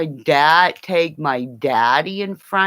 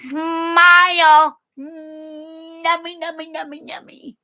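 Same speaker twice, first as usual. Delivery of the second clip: very high squeaky put-on voice, fading to near silence; high mock diva voice cooing over her teeth; 5 dB louder than usual. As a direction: ham up a vain starlet showing off new teeth. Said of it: "be like that Cher chick".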